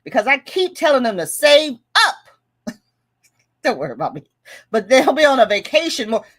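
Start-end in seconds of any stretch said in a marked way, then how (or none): 0.0-2.7 higher, louder acted rant; all-caps emphatic. Reading a fed-up viewer's comment aloud, loud and emphatic. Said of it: "Let me say it like she said it". 4.7-6.2 loud, higher mock rant; all-caps emphatic. Vlogger performing a viewer's complaint about borrowers, loud and emphatic. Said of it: "Let me say it like she said it".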